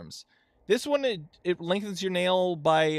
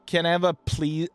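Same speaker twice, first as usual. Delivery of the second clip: ordinary talk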